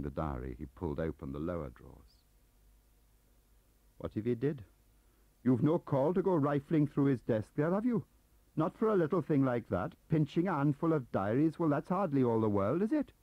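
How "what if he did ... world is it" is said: put-on strong, higher, louder Welsh accent; indignant Welsh lilt running free. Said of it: "His Welsh accent had jumped all the hurdles and was running free".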